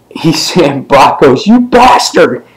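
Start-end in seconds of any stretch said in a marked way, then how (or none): 1.5-2.4 high-pitched indignant exclamation; mock outrage. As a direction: curse in hammed-up, high outrage. Retells Brock's angry outburst while burning.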